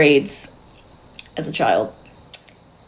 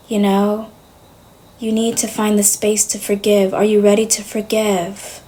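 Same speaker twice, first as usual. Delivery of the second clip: softer, lightly voiced British therapist; mock soothing counsellor, a touch quieter; a hint of a gentle British therapist urging forgiveness; imitating the therapist; 4 dB quieter than usual. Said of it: "She was like".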